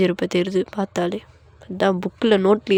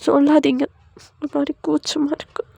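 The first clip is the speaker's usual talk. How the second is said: high, bashful little voice; shy, embarrassed; 6 semitones higher than usual. Acted. Wife teased by husband, bashful and embarrassed. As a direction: squirm shyly through the words. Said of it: "வெட்கப்படுறியா".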